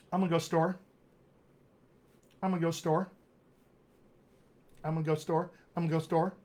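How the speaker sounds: "I'm gonna" squashed to "I'm-uh", "to the" swallowed; "go store" with the little words melted away; heavily smushed. Shown as a demonstration. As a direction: run "I'm gonna go store" together as one word. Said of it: "Smush it even further".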